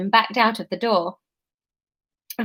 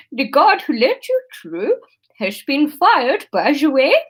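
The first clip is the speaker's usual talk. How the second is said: bad Dutch accent; 3 semitones higher than usual. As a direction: put on a clumsy Dutch accent. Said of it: "integral to my Dutch accent".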